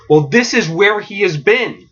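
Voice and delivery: normal talking voice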